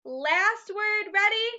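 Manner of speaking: high eager question to the class; eager cheer. Real, not acted. Teacher rallies class.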